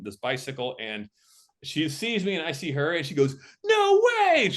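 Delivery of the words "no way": loud, high-pitched squeal of recognition; big high exclamation, drawn out; delighted disbelief. Retold or imitated. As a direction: imitate a friend shrieking at a surprise reunion. Retold excited greeting on set.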